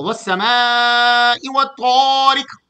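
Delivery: slow, drawn-out melodic tajweed recitation; Quran recitation. Acted. Preacher begins the day's surah for the congregation, slow melodic recitation. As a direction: stretch every vowel in measured chant.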